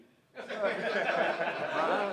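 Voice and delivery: a big, loud real laugh; loud burst of laughter. Real, not acted. Speaker laughing at Brian giving him the finger, loud laugh. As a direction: crack up loudly.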